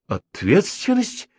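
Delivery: slow, high frightened question; frightened echo. A narrator's reading. Professor frightened by the policeman's threat, slow rising question. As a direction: she repeats the word in alarm, drawn out and rising. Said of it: "испугалась Елена Петровна".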